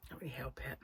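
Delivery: fading into a whisper. Real, not acted. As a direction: let it fade to a whisper. Trailing rueful aside.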